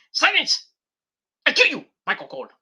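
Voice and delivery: high yell, then a fast rushed threat; hammed-up villain silencing the announcer; mock wrestler shout. Wrestler threatening Michael Cole in a fast, hammed-up yell. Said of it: "what Nick just said".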